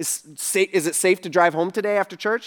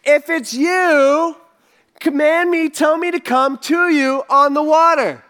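higher, loud eager call; Peter's eager yell, pitched up; 6 semitones higher, 5 dB louder, slower than usual. Retold or imitated. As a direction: shout across the water to Jesus. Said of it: "he yells out".

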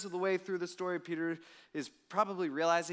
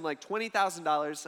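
usual speaking voice

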